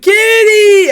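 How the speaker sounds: high, loud squeal of a name; excited high call. Retold or imitated. Owner calling her cat in delight, high loud squeal. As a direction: call the cat like you're thrilled to see her. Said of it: "because I was like".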